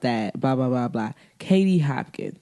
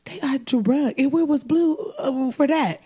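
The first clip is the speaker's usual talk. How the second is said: mock incredulous; 9 semitones higher than usual. Incredulous aside in quote.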